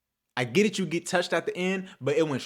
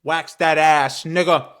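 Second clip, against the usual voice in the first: gloating; 7 dB louder, slower than usual. Real, not acted. Gloating over his team's win.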